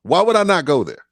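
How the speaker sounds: high, loud exclaimed question; voiced own reaction, pushed up high; incredulous.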